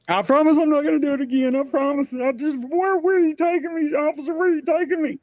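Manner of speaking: high frantic begging, far above his own voice; panicked pleading. Retold or imitated. Retold panhandler begging the cop in a panic. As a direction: plead like a terrified prisoner.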